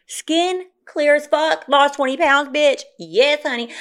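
high, slow, drawn-out boast; proud bragging. Real, not acted. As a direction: savor every word of the brag. Bragging about her new mugshot.

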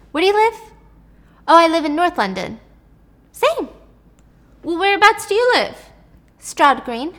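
high, excited back-and-forth; a flirty pair finding out they're neighbours, voiced giddy and high; giddy excitement. Excited chat about living nearby.

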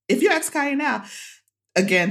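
usual speaking voice

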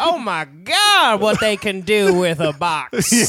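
slow, loud, drawn-out awe; mock awed dad. Imitating dad marvelling at the drummers. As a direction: stretch it out in wonder. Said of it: "He goes".

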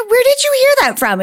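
high, fast startled question; a mother caught off guard; mock alarmed mom.

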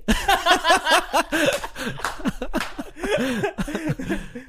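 a long, high burst of laughter. Real, not acted. Laughing at his own mock agreement.

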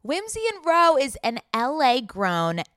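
loud, emphatic brand introduction; ad read. Real, not acted. Co-host introducing Whimsy and Row, loud ad read. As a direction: announce the brand with big stretched emphasis. Reads a clothing ad.

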